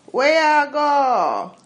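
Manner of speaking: high, loud, slow drawn-out patois; dragged downtown Jamaican. Shown as a demonstration. Showing how downtown Kingston folk drag their patois. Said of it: "they drag it".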